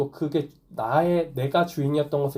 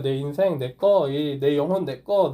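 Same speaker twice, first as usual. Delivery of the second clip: ordinary delivery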